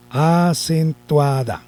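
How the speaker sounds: "Acentuada" drawn out slowly, syllable by syllable; slow careful correction. Shown as a demonstration. Teacher correcting her slip for the class, slowly. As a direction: spell out "Acentuada" slowly and clearly. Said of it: "Eso, ahora sí".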